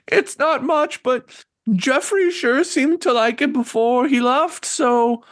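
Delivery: put-on high-pitched elderly hostess; apologetic old woman. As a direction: offer it modestly in a high old-lady voice. Game master plays old woman.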